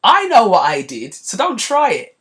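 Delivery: "I know what I did" loud, then "so don't try it" high and knowing; cocky mock husband pushing back; smug gaslighting. Husband gaslighting his wife, loud and smug. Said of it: "That kind of gaslighting".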